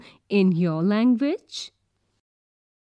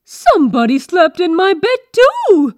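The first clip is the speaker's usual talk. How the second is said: loud, high-pitched mother bear cry; Mama Bear; 6 semitones higher, 9 dB louder than usual. A narrator's reading. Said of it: "said Mama Bear".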